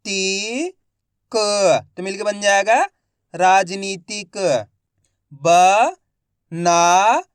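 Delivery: each syllable said alone, loud and clipped, then the whole word drawn out slowly; slow Hindi reading drill, syllable by syllable; phonics sounding out. Shown as a demonstration. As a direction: spell it out syllable by syllable for a child, then blend it slowly. Teacher sounds out and blends words.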